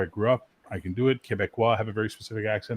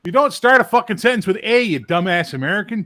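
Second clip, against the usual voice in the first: loud scolding; 7 semitones higher, 7 dB louder than usual. Real, not acted. Scolding the American's fake accent.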